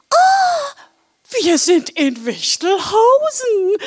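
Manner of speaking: very high, drawn-out squeal of joy; delighted calling out. Acted. Blodi spots the village ahead, delighted cry. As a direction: squeal it slowly and loudly as Blodi. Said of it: "rief Blodi erfreut".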